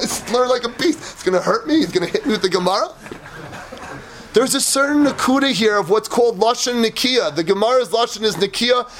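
ordinary talk

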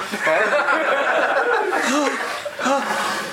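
high, laughing theatrical gasp; mock shocked gasp. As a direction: gasp in feigned horror, half laughing. Shocked reader of the ksuva.

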